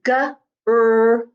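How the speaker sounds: "G-R-O" as separate sounds guh, er, oh; sounded out. Shown as a demonstration. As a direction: sound out "G-R-O", stretching each sound. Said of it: "That O sound is not just O".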